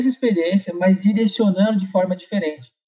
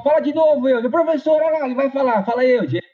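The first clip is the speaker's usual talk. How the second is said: teasing classmates; 5 semitones higher than usual. Retold or imitated. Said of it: "E aí ele, pessoal".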